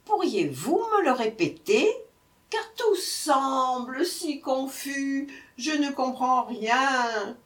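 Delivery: high, slow, puzzled whine; bewildered jackal. A narrator's reading. Jackal asking the brahman to repeat, bewildered. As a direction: draw it out, helplessly muddled.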